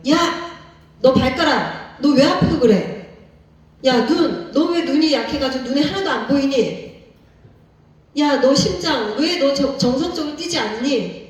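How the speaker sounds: angry scolding. Pretend. Pastor scolds his own body parts, mock angry yelling. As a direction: yell at your toe, eye and heart in mock anger. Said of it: "막 화를 내는 거예요".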